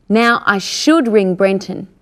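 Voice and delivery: ordinary delivery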